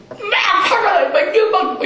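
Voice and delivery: high hammed-up yell with a laugh; mock angry child shout. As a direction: act out the child flaring up, laughing. Child's anger acted out.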